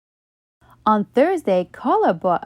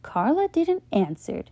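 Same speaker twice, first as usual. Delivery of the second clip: usual speaking voice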